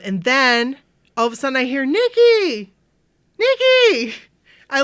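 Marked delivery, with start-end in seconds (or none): 1.9-4.1 high, loud mimicked hail; calling out. Woman retells hearing her name called, high excited shout. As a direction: imitate a friend calling out excitedly.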